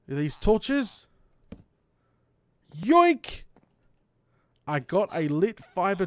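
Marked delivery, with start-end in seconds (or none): 2.8-3.4 playful yoink. Real, not acted. Grabs the torches.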